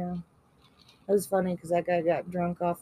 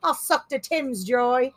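put-on slow, higher-pitched rough English accent; 4 semitones higher, 4 dB louder, slower than usual. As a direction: drag it out in mock street English.

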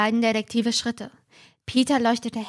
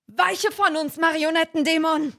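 high, commanding banishment; hammed-up spell against a demon; mock exorcism; 6 semitones higher than usual. [name] schwingt das Kreuz. (pathetisch beschwörend, höher)

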